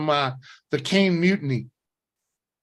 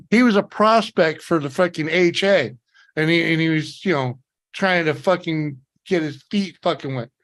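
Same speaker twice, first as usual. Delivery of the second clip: usual speaking voice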